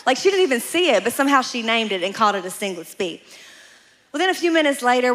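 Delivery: ordinary talk, nothing marked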